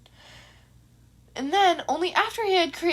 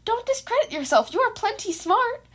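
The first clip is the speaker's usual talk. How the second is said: much higher, bright encouraging chirp; chirpy; 9 semitones higher than usual. A narrator's reading. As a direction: cheer up a doubting student. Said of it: "Nezu chirped out".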